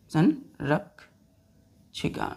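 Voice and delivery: संरक्षिका drawn out very slowly; teacher dictating संरक्षिका slowly; slow dictation. Shown as a demonstration. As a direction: stretch संरक्षिका for writing. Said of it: "slow dictation".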